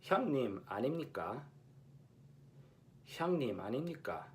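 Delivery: fast 형님 아닙니까 with every ㄴ sounded; Seoul standard, full 아닙니까; crisp Seoul Korean; Seoul pronunciation. Shown as a demonstration. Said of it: "the example is".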